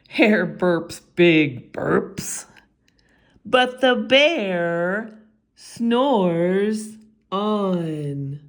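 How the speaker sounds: usual speaking voice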